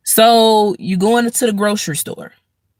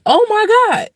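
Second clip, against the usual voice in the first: exasperated oh my god; 14 semitones higher than usual. Retold or imitated.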